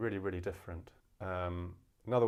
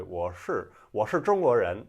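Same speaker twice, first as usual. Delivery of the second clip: r-coloured "shi" in a Beijing accent; 8 dB louder than usual. Shown as a demonstration. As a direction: punch out the Beijing r-sound. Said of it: "sounds a bit more like it's got some R in it".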